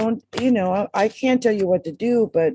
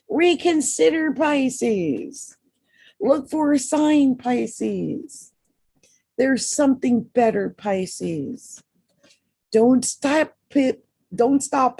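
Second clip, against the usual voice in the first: higher, emphatic read-back; 6 semitones higher, slower than usual. Shown as a demonstration. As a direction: proclaim each card message, lifting the voice. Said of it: "I read 'em back".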